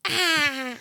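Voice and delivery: high, drawn-out "ah" sound; imitated fart noise. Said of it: "it's like".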